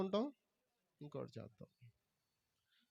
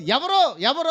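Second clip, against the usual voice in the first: urgent demand; 9 semitones higher, 12 dB louder, slower than usual. Acted. Jesus demanding who touched.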